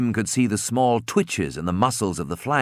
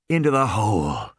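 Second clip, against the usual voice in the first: ordinary delivery, nothing marked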